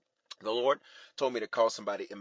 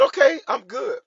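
loud, high-pitched reply; emphatic acceptance; 13 semitones higher, 13 dB louder than usual. Retold or imitated. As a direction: give in loudly, high up in the voice. Acting out own reply to the Lord.

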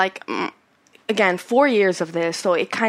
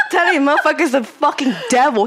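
talking through laughter, a bit louder; laughing; 4 semitones higher, 4 dB louder than usual. Real, not acted. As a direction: get the words out while cracking up. Laughing at the ghost joke.

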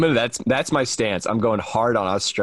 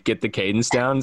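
ordinary talk

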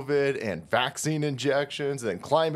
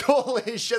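usual speaking voice

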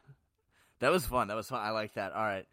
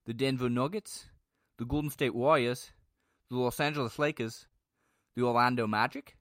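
loud put-on Australian announcer read; Australian accent; 3 semitones higher, 5 dB louder than usual. Host reading three-game teams to podcast listeners, Australian accent. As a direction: read the list in a big, rising Australian accent. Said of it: "I was Australian".

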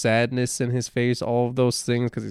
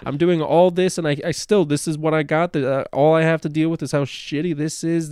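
higher, exasperated mock rant; frustrated outburst; 6 semitones higher than usual. Patient in therapy venting that nothing changes, pitched up and exasperated. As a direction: vent like a fed-up patient getting nowhere.

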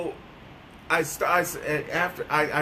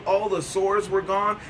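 normal talking voice

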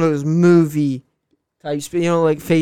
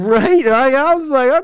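pitched up and loud with hype; fired up, nearly shouting; excited; 10 semitones higher, 8 dB louder than usual. Real, not acted. Hyped after motivational speech.

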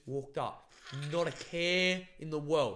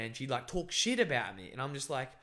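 ordinary talk, nothing marked

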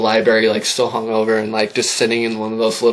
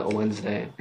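ordinary delivery

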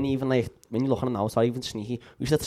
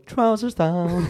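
drawn-out call; 4 semitones higher, much slower than usual. Retold or imitated. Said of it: "you hear someone go".

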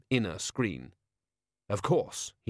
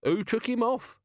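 high woman's voice; lightly voiced woman; a woman asking; 12 semitones higher than usual. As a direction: ask it in a woman's voice. Women's voice in story.

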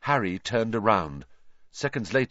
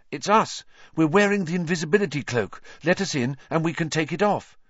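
put-on higher, urgent boy's plea; urgent young boy; 7 semitones higher than usual. A narrator's reading. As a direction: plead quickly to be let in. Harry calls at Hagrid's door.

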